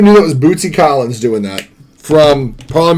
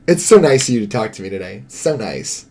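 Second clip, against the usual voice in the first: low, quick, fading thanks; insincere politeness; 6 semitones lower, 5 dB quieter than usual. Retold or imitated. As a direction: say it low with no conviction. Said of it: "your tone said otherwise".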